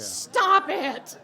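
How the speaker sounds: very loud, high mock reprimand; comic scolding shout. Preacher shutting down a spiritual show-off, comic scolding shout. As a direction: yell it to shut down the boaster.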